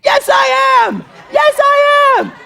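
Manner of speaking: put-on a small boy screaming back at his class; screaming child. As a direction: scream it like a defiant little boy. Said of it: "he just starts screaming".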